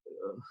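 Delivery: soft grossed-out groan; a quiet mock groan of distaste; a little disgusted ugh. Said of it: "you know, like this".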